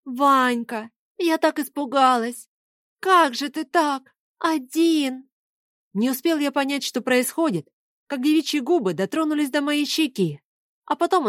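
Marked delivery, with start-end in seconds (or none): 0.0-5.3 tearful. A narrator's reading. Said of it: "попеременно всхлипывая".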